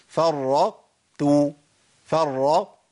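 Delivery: فرطت very slowly, with the first tāʾ emphatic; held ṭāʾ demo. Shown as a demonstration. Teacher showing how to say فرطت. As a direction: stretch فرطت, keeping the emphatic. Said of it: "أجيبها إزاي".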